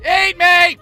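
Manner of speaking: high, loud forced cheer. Acted. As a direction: belt it with fake enthusiasm. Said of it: "Louder and with more enthusiasm".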